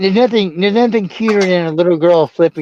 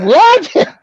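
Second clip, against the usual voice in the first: high, loud shocked what; 4 dB louder than usual. Retold or imitated. Uncle shocked at toddler's swearing, high and loud. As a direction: jump up in disbelief. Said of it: "I was like".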